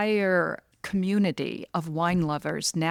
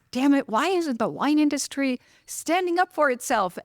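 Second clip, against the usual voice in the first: higher, mildly exasperated retold grumble; exasperated self-talk; 8 semitones higher than usual. Wine lover grumbling to herself on a dog walk, mildly exasperated. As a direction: grumble a frustrated question at yourself. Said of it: "I was muttering to myself".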